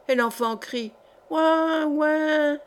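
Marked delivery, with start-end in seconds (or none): 1.3-2.6 high, whiny imitation of a crying baby; mock baby wail. Tipsy maid imitating the newborn's crying, mock wail. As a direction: squeal it like a newborn, hammed up. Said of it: "piailla encore une fois".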